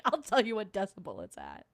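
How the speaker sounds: ordinary delivery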